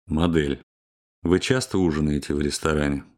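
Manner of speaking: ordinary delivery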